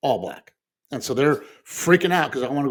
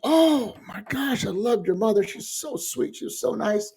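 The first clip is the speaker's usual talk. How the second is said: gushing woman; 10 semitones higher than usual. Retold or imitated. Woman greeting the son at the memorial, high gushing voice. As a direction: gush sweetly over the late mother.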